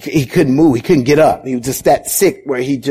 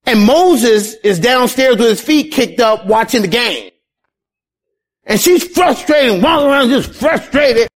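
sermon climbing in pitch and urgency; impassioned preaching; 8 semitones higher than usual. Acted. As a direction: preach it higher and higher, stretching the key words. Preaches with rising force.

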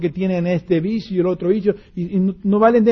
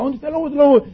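papá sobresaltado; 9 semitones higher, faster than usual. Acted. Papá salta alarmado otra vez.

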